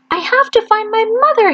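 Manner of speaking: put-on high small voice of a lost baby bird. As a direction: ask it as a tiny hopeful chick.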